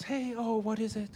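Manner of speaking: high, soft, sweet-as-pie question; mock all-smiles cheer. Smiling woman asked to wait a minute, mock cheerful. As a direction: imitate a bright, unsuspecting reply. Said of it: "she's sitting there all smiles".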